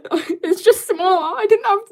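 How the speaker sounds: high, loud, laughing excuse; laughing protest. Real, not acted. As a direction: protest helplessly through real laughter. Laughing at own drawing mishap.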